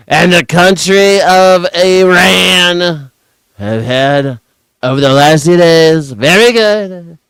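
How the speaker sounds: pompous hammed-up president; mock Trump. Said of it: "Donald Trump".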